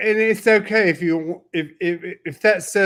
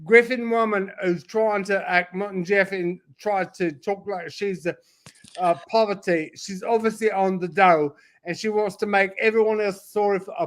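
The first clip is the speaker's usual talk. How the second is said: mock Cockney rant. Cockney impression for laughs, hammed-up London accent. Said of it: "I'm switching to Cockney".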